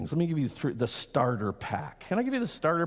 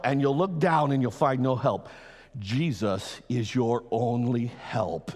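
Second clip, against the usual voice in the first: usual speaking voice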